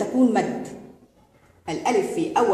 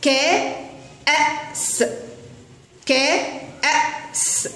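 higher, very slow, voice fading to air; high breathy spelling out; 5 semitones higher, much slower than usual. Shown as a demonstration. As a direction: sound out كأس slowly, high and airy. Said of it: "فينطق بصوته". (نطق كأس ببطء بصوت عال النبرة وهامس)

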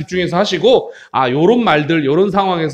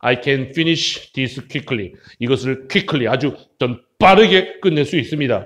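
low, soft, unhurried dialogue voice; low-voiced confident character; 6 semitones lower, 5 dB quieter, much slower than usual. Classmate saying the quiz is easy, low calm voice. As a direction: drop pitch and volume, slow the English. Teacher acting the confident second speaker.

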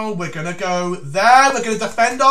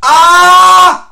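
sudden high loud yelp of panic; a sharp startled cry, real alarm; 8 semitones higher, 14 dB louder than usual. Real, not acted. Panicking during chess time scramble.

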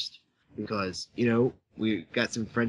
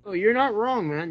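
emphatic agreement; 9 semitones higher than usual. Real, not acted. Agreeing emphatically with the freakout remark.

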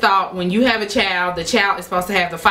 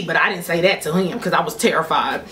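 normal talking voice

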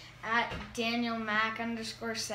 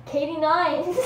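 higher, louder laughing repeat; excited; 5 semitones higher, 8 dB louder than usual. Real, not acted. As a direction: say it again, brighter and louder. Repeats guess after laughing.

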